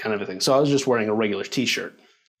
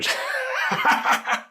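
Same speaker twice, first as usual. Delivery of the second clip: high-pitched imitated madman laugh. Bleeding teller acting out laughing like an insane madman, high cackle. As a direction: cackle high like someone losing it. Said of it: "Just laughing my ass off".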